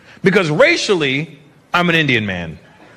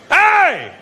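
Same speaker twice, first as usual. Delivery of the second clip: high, drawn-out mock shout; high-pitched imitated street holler; calling out; 12 semitones higher than usual. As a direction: yell after a stranger from behind. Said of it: "behind me I hear".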